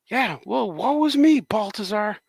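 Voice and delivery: high, put-on character taunt; hammed-up sarcastic lament; mock woe. Acted voice mocking his complaints.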